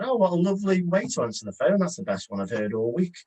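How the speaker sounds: bright, flattering lift on the first line; charming compliment. Shown as a demonstration. Caller flattering the receptionist's greeting, charming. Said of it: "a bit of charm as well".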